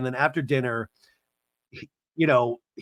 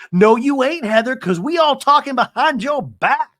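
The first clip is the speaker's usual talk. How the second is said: higher, louder sassy clapback; sassy mock retort; 10 semitones higher, 7 dB louder than usual. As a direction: snap back with high mock sass. The girls' imagined reply.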